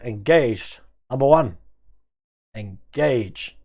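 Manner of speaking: lightly hammed-up Captain Picard; Picard impression. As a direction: issue the order to Number One, grandly. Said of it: "Like Picard".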